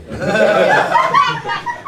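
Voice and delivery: high helpless laugh over the words; can't stop laughing; you know lost in a giggle. Real, not acted. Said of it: "You have a contagious laugh".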